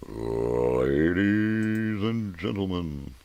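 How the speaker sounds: long, stretched-out ring announcer call, high and loud. Pretend. Host calling ladies and gentlemen, drawn-out ring-announcer bellow. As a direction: draw every word right out at full volume. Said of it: "a ring announcer".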